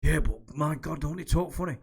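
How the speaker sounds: lightly hammed-up incredulous protest; imitated disbelief. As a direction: act out a mate who can't believe his ears. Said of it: "couldn't believe it that people talk funny".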